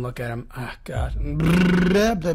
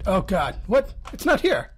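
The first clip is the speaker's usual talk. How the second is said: ordinary talk, nothing marked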